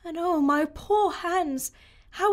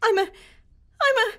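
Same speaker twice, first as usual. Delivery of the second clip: flustered stammer; 8 semitones higher, 4 dB louder than usual. Acted.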